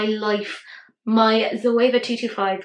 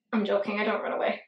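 ordinary talk, nothing marked

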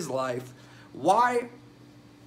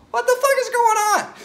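yelled high and loud in disbelief; real shocked shout; shocked outburst; 9 dB louder than usual. Real, not acted. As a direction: blast it out, horrified. Reacting to child marriage story.

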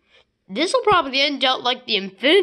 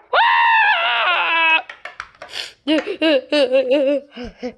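evil laugh; 4 semitones higher than usual. Acted. Villain laughs loudly.

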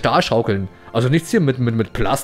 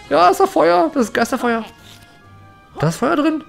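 excited high voice; 10 semitones higher than usual. Pretend. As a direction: squeal about the ghost fire. Excited high voice about fire.

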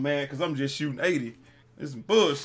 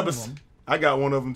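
ordinary talk

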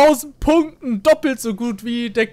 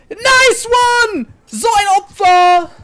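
sarcastic outburst; 7 semitones higher, 3 dB louder, slower than usual. Real, not acted.

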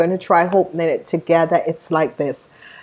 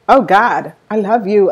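normal talking voice

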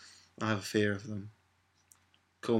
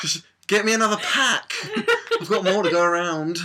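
laughing imitation; 8 semitones higher, 7 dB louder than usual. Imitating dad giving blood.

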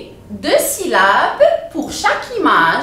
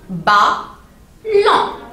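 BALLON split in two, each syllable held apart; découpé en syllabes. Shown as a demonstration. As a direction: break "Ballon" into two beats with a pause. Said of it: "par syllabe". (« Ballon » en deux syllabes bien séparées)

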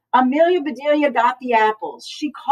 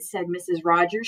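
plain reading voice

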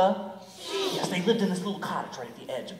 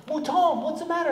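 cat voice; 13 semitones higher, 7 dB louder than usual. Acted. Cat asks again.

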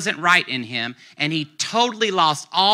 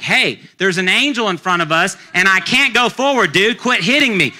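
higher, louder hammed-up donkey voice; complaining donkey; 5 semitones higher, 5 dB louder than usual. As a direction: protest like a fed-up beast being beaten. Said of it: "So the donkey's like".

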